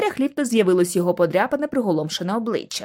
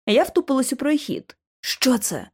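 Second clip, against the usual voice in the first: usual speaking voice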